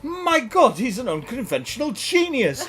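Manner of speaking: high, loud gushing jury voice; hammed-up awestruck jury; awed jury. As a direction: gush like an amazed crowd. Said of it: "And all the jury go".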